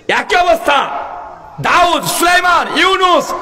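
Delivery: sustained, ringing preacher's cry; full-force preaching. Real, not acted. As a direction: call each name out high, rising then falling. Preacher calls out prophets' names.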